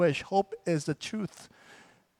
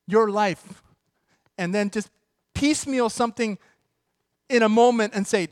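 high, loud preaching build; full-force preaching; 4 semitones higher, 5 dB louder than usual. Real, not acted. Pastor preaching to the congregation about God's plan, full-force. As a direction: preach it out to the back row.

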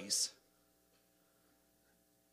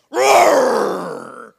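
big shouted roar, much louder; loud lion roar imitation; mock lion roar; 2 semitones higher, 19 dB louder than usual.

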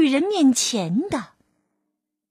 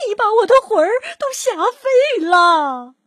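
higher, louder mock-terrified cry; old woman crying out she's scared to death; hammed-up fright; 9 semitones higher, 5 dB louder than usual. A narrator's reading. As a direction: play the scared old lady big. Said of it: "瘦小的老妇人喊起来".

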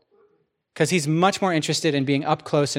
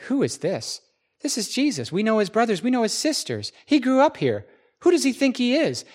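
mocking, much higher scornful voice; scoffing hometown crowd; 7 semitones higher than usual. Hometown people scoffing at Jesus, mocking disbelief. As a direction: sneer at him like a neighbour who knew him as a boy.

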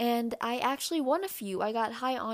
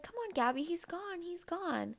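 soft, higher coaxing; coaxing dad; 9 semitones higher, 3 dB quieter than usual. Retold or imitated. Dad softly coaxing his shy daughter, higher and softer. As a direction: coax her gently out of the room. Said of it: "he was like".

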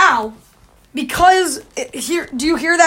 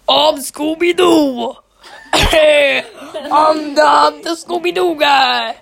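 slow, laughing mock Scooby-Doo; a lightly voiced dad playing Scooby; imitating dad's Scooby-Doo; 3 dB louder, much slower than usual. Kid retelling his dad's Scooby-Doo voice, slow and laughing. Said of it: "That's how he sounded".

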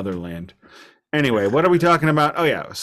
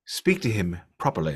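low, soft upper-class English voice; upper-class English accent; 5 semitones lower, 5 dB quieter than usual. Retold or imitated. Child repeating the correction to a parent, upper-class English accent. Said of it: "we're doing accents now".

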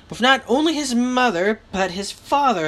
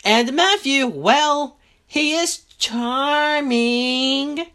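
higher, drawn-out character voice; 4 semitones higher, much slower than usual. A narrator's reading. Reader redoing a character's line about Matthew, higher and drawn-out. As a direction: lift the pitch and linger slowly.

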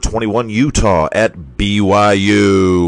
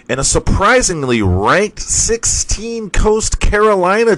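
surprised; 10 semitones higher than usual. Real, not acted. Surprised at the ranking.

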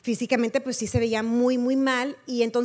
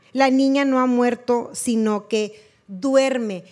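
louder, projected Bible reading; scripture reading; 5 dB louder than usual. A narrator's reading. Preacher resuming the Bible passage for the congregation, loud projected reading. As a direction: read the verse out firmly to the congregation.

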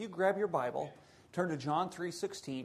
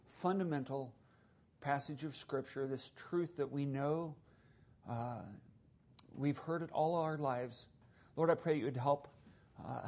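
softer, earnest spoken prayer; 4 semitones lower, 4 dB quieter than usual. Acted. Pastor praying for the congregation, soft and earnest. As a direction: pray quietly on behalf of all.

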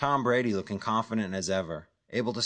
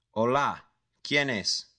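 guarded caller answering in Spanish; Spanish-speaking caller; 4 semitones higher, slower than usual. A narrator's reading. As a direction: pick up like a wary stranger. The stranger answers the phone.

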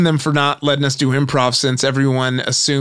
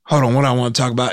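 rushed stand-up opener; 2 semitones lower, much faster than usual. Retold or imitated. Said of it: "he's like".

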